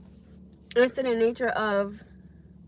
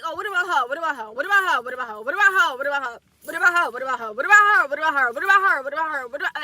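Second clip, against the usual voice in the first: repetitive nagging; faster than usual. Retold or imitated. Retelling how she kept pushing another woman on him, nagging singsong. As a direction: rattle it off over and over, pitch bouncing.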